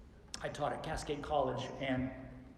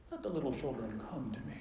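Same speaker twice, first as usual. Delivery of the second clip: mild, gentle; faster than usual. Shown as a demonstration. Jesus calmly inviting children, mild and gentle. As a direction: say it gently, without any anger. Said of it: "I don't think he said it like this".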